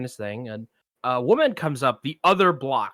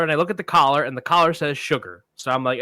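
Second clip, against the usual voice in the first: normal talking voice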